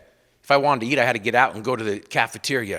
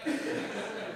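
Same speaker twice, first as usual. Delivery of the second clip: breathy laugh; 6 semitones higher, 15 dB quieter than usual. Real, not acted. Laughing at own dream.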